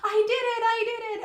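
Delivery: high-pitched mock cry of triumph; elated cry, retold. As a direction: re-enact waking up overjoyed, pitch way up. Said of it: "I started laughing and crying and going".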